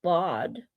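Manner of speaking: Laud gezegd als lahd, zacht en laag; saying Laud. Shown as a demonstration. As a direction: spreek Laud uit met een lange open a. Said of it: "asks me if I can say".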